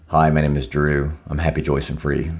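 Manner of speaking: sarcastic imitation of a recovery share; mock meeting greeting. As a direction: parody the cheesy meeting chant, fading out. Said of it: "sit in a meeting going".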